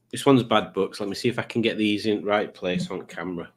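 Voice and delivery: usual speaking voice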